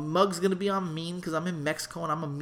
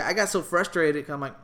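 normal talking voice

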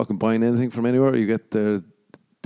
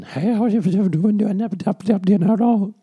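much higher, garbled Irish chat-up babble; hammed-up self-mockery, words tumbling into gibberish; chatting up; 11 semitones higher, 5 dB louder than usual. Irishman chatting up a woman at a bar, babbling high. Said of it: "an impression of myself chatting up a woman".

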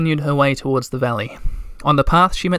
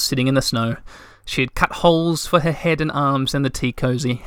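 plain reading voice

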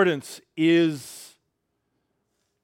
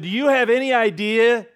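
higher, louder, hammed-up outrage over chili dogs; mock outrage; 5 semitones higher, 3 dB louder than usual. Pastor tells a church member his mock outrage. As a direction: sell the temptation like a comic rant.

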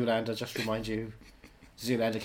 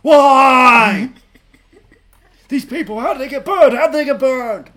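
anguished cry; 11 semitones higher, 16 dB louder, slower than usual. Acted. Hammed-up anguished conspiracy cry.

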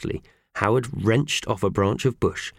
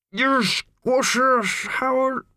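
muffled; much slower than usual. A narrator's reading. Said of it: "said Mickey Thompson muffledly".

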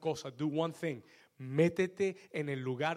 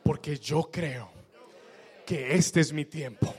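strong declaration; 4 dB louder, slower than usual. Shown as a demonstration. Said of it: "diga en voz fuerte".

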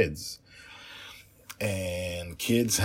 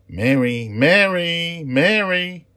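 rising, loud calls, each higher; calling out; 12 semitones higher, 12 dB louder than usual. Acted. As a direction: call louder and higher each time. Child calls for lost friend.

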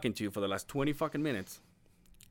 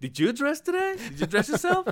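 mock baby talk; 12 semitones higher than usual. Mocking him like a child.

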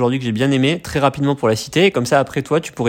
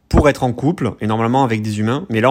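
usual speaking voice

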